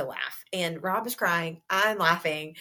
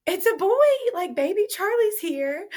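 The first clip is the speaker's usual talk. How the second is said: high, loud burst of joy; excited announcement; 11 semitones higher, 5 dB louder than usual. Retold or imitated. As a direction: announce the baby like big news. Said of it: "but Rob just goes".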